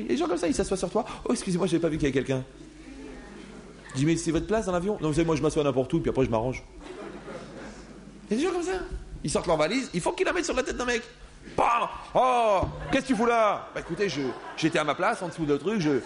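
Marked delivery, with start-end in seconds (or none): 1.3-2.4 fast casual excuse. Retold or imitated. Stranger on the plane seat. 11.6-13.7 mock indignant. Passenger hit by suitcase.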